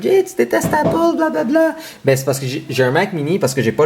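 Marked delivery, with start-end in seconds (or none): 0.0-1.7 much higher mocking voice of the critics; mocking others. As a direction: mimic everyone teasing Jay, pitched way up. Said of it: "tout le monde fait comme".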